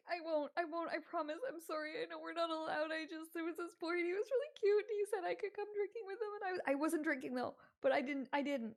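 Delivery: high, rushed tearful excuses; teary pleading girl. Acted. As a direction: babble excuses through tears. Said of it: "ugly crying".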